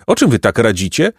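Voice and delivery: usual speaking voice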